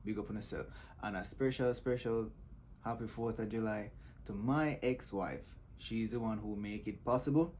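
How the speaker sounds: ordinary talk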